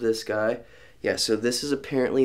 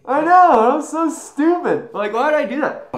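exasperated; 8 dB louder than usual. Real, not acted. After spit, loud self-reproach.

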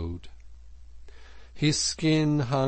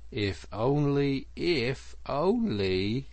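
slow, higher, drawn-out doubt; dubious; 5 semitones higher, slower than usual. A narrator's reading. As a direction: let each "only" hang sceptically. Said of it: "observed the Rat dubiously".

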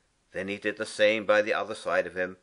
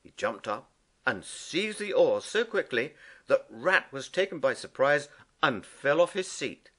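ordinary delivery

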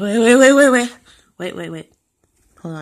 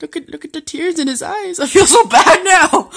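high, giggly pity; high-pitched, laughing sympathy; laughing guilt; 6 semitones higher, 3 dB louder than usual. Real, not acted. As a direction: laugh through feeling bad for the dog. Laughing over dog's tears.

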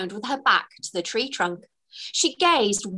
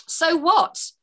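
defiant; 7 semitones higher than usual. A narrator's reading. Fairy covering embarrassment, defiant. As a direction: shrug it off defiantly. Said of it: "trying to sound defiant".

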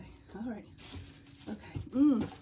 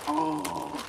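ordinary delivery

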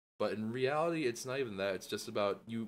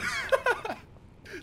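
loud high burst of laughter; real laugh at own blunder; laughing at oneself; 8 dB louder than usual. Real, not acted. Gamer laughing after goofing up, high loud laugh.

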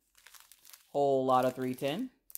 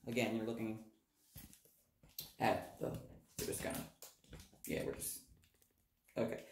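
fading to a murmur; trailing off quietly; 4 semitones lower, 11 dB quieter, faster than usual. Real, not acted. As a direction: mumble it away. Fumbling in dark bathroom.

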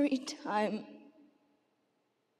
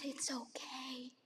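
soft slow whispered comfort; gentle unvoiced reassurance; 4 semitones lower, 18 dB quieter than usual. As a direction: hush it to the child. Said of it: "And I said".

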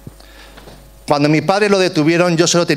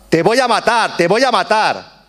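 retold shout; 6 semitones higher than usual. Father threatening the Guardia Civil officer, high mock yell. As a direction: replay the father's threat as a raised, higher cry. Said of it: "mientras mi padre gritaba".

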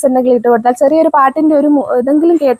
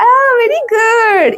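delighted praise; 9 semitones higher, much slower than usual. Real, not acted. Mother praising her toddler for fetching, delighted. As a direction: stretch the praise out high and slow.

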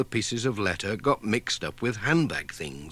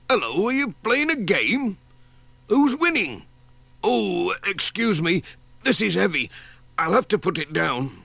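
cheery grocer; 9 semitones higher than usual. A narrator's reading. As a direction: call out like a breezy delivery man. Voices Sam Waldron.

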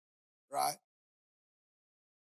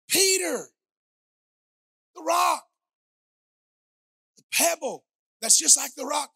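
louder, higher fervent sermon delivery; full-force preaching; 9 semitones higher, 11 dB louder than usual. Acted. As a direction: preach it out to the congregation. Preaching climax.

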